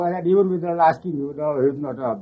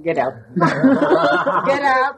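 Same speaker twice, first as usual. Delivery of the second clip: laughing order; 11 semitones higher, 4 dB louder than usual. Retold or imitated. Baba ordering them off, laughing.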